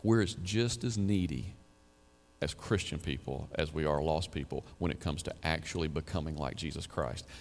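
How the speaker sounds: ordinary delivery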